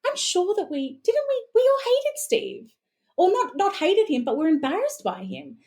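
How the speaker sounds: high, disbelieving protest; incredulous inner voice. Retold or imitated. Asking herself about loving Steve Irwin, high and incredulous. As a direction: insist in baffled disbelief. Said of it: "And I sit there going".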